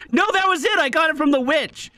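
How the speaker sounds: sudden high excited outburst; real excitement, pitch jumping up; excited realization. Real, not acted. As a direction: blurt it out, thrilled to remember. Streamer realizes during run.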